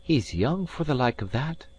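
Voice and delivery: lightly voiced Scots lass; young maid voice. Maid's voice in story.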